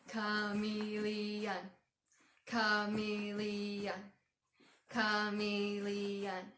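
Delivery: "Chameleon" in four slow, even beats; "Chameleon" as a level, deliberate stomp-along chant; stomped syllables. Shown as a demonstration. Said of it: "stomp it with our feet together".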